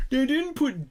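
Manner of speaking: high, whiny mock complaint from a fan; complaining book fan. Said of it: "they're like, a book adaptation".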